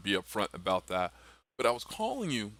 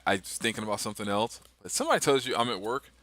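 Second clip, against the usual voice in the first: normal talking voice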